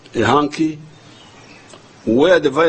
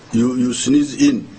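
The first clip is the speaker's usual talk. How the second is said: ordinary talk, nothing marked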